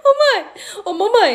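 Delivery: imitating a daughter, high-pitched call; mock child's call for attention; daughter calling mom. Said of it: "minha filha vira pra mim e fala assim".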